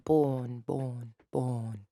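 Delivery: "born" repeated with no r, long vowel; British vowel drill. Shown as a demonstration. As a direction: practise "born" slowly as a clumsy British exercise. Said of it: "I'm doing it really horribly now".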